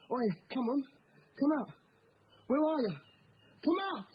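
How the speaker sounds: high calling, climbing with each try; shouting to someone hiding. Acted. Seeker calling a hidden playmate out, shouting high. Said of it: "What's all the shouting?".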